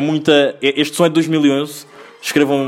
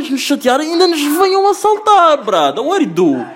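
high, exasperated telling-off; 8 semitones higher than usual. Retold or imitated. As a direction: plead with him to drop it. Retold telling friend to back off.

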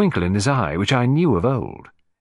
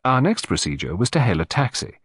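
ordinary delivery

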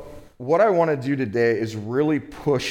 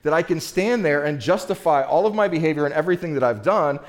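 rising preaching intensity; 6 semitones higher than usual. Real, not acted. Preacher to congregation, imagining justifying himself, in a fervent, raised voice. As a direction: build the plea with fervor before God.

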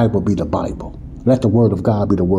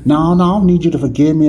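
people refusing forgiveness; 5 semitones higher, 4 dB louder, faster than usual. Retold or imitated. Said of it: "the minute people say".

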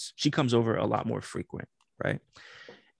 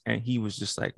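ordinary delivery

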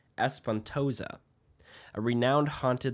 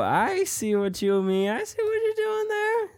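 much higher and louder, squealing; excited squeal; 13 semitones higher, 10 dB louder than usual. Real, not acted. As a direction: squeal with delight at the tease. Excited reaction to the cliffhanger tease.

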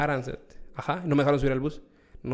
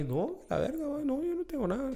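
higher, softer, feigned nonchalance; faking calm; 12 semitones higher, 7 dB quieter than usual. Retold or imitated. To the police officer, mock casual denial. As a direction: act casual while panicking inside. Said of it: "Pero afuera estaba así".

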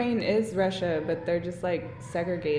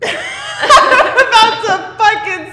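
much higher and louder, laughing through it; laughing mock threat; 13 semitones higher, 16 dB louder, slower than usual. Real, not acted. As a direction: threaten her while cracking up.

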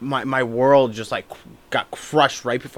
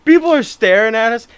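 normal talking voice

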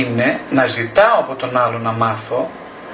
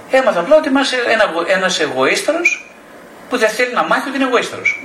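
mock sarcastic answer; 8 semitones higher than usual. Mocking answer, high shift.

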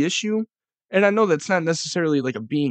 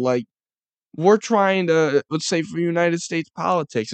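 ordinary talk

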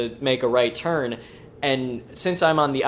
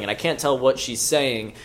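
usual speaking voice

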